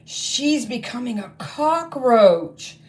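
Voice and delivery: slow, high amazed exclamation; astonished exclaim. Acted. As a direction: call it out in wonder. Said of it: "exclaimed Gloria".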